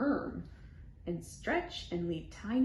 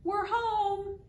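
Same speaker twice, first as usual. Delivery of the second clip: loud, higher happy call; shouting home; 11 semitones higher, 4 dB louder than usual. Retold or imitated. Kids drip in the doorway, shouting loudly higher. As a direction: burst in calling out.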